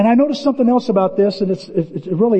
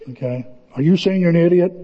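ordinary delivery

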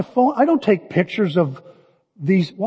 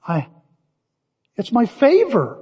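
normal talking voice

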